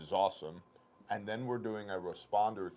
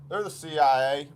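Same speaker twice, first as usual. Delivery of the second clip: offhand coworker; 7 semitones higher, 12 dB louder than usual. Retold or imitated. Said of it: "she goes".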